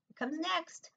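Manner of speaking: high, teasing question to the class; bright sing-song teacher prompt; quiz question. Shown as a demonstration. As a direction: lift the voice to invite an answer. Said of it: "teacher quizzing children".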